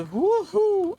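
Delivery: high, loud "woohoo"; excited whoop. Real, not acted. Gamer surviving a crash, high loud whoop.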